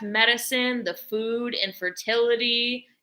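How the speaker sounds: ordinary talk, nothing marked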